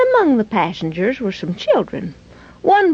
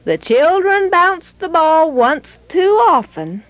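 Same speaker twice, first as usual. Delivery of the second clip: ordinary delivery, nothing marked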